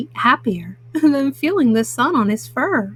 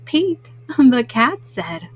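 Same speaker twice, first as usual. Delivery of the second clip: plain reading voice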